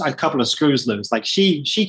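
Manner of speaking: ordinary delivery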